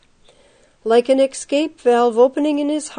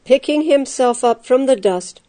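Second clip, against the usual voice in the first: ordinary delivery, nothing marked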